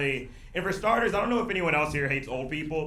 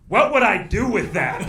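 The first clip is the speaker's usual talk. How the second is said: louder, higher retort of disbelief; mock incredulous reply; 5 semitones higher, 7 dB louder than usual. Fan rejects comic's photo offer, imitated incredulity. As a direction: throw it back as a baffled question. Acts out fan's dismissive reply.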